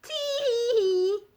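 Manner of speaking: high, slow giggly laugh; high giggle. A narrator's reading. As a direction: giggle gleefully like a cheeky cookie. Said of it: "laughed the gingerbread man".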